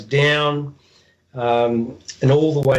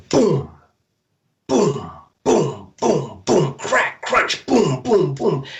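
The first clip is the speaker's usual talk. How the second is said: imitated footsteps; 4 semitones higher, much slower than usual.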